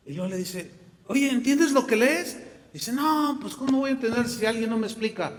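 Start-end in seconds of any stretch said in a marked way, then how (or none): 1.1-2.4 higher and louder, friendly call; Felipe calling out. Acted. Felipe hailing the man in the carriage, calling out. 2.9-5.3 high, quick, bewildered reply; puzzled reader. Acted. The reader answering Felipe, high and puzzled.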